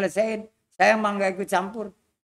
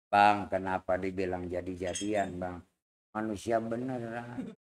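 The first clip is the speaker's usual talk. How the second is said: put-on gentle old man; 12 semitones lower, 4 dB quieter than usual. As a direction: reason softly like a wise elder. (suara kakek rendah, lembut dan pelan)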